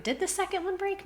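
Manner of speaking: surprised doubt. Real, not acted. Suddenly doubting her own claim.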